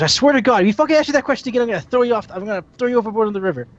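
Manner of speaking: hammed-up fed-up outburst; mock exasperated threat. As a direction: blow up at the pest, rushing. Dumpling loses patience in joke.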